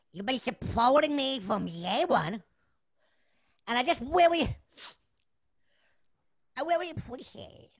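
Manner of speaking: halting, tender puppet-character voice; emotional little chef. Acted. Little chef thanking fans, haltingly emotional. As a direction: struggle through heartfelt thanks with long pauses. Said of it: "madam, I'm getting emotional too".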